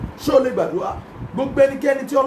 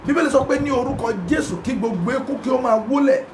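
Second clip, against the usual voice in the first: ordinary talk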